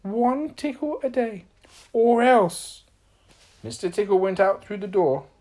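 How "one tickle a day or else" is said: high, slow, loud at the end; warning reminder. A narrator's reading. Little Miss Magic warns Mister Tickle, high slow firm reminder. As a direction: spell out the rule as a warning. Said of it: "she reminded".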